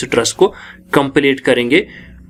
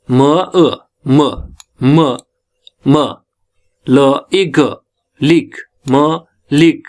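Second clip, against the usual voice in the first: syllable by syllable; much slower than usual. Shown as a demonstration. Teacher breaks a four-syllable word down for students, syllable by syllable. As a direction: break "मालिक" into separate sounds, "म", "ल", "इ", "ग". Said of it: "syllable का है".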